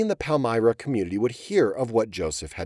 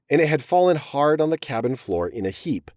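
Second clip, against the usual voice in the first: plain reading voice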